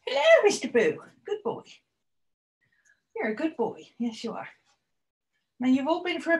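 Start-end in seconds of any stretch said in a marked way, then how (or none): 0.1-4.5 pet talk. Real, not acted. Greeting the dog.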